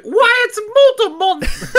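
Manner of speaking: put-on very high, strained Cockney boy; high little boy voice. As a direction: squeeze the voice up like a small boy. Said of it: "your voice needs to go higher".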